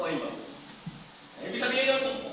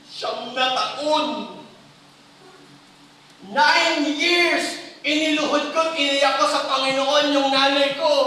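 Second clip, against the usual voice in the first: higher, louder, slow tearful plea; the head pastor telling the youth, louder and higher, pleading; pastor's tearful testimony; 6 semitones higher, 6 dB louder, slower than usual. Retold or imitated. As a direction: voice the pastor pouring out his years of prayer. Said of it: "Sabi niya".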